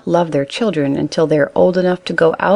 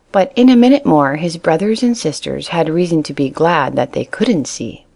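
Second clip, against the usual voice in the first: ordinary delivery, nothing marked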